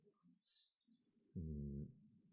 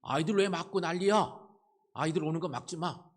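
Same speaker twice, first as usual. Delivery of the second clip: loud, high indignant scolding of the disciples; loud rebuke; 10 semitones higher, 7 dB louder than usual. Retold or imitated. Jesus rebuking the disciples for blocking the children, loud and high. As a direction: call them out sharply for blocking the kids. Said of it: "이렇게 말씀하신 거죠".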